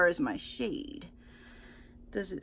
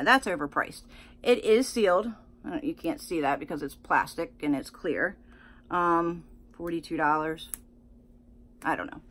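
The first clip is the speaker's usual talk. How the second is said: ordinary talk, nothing marked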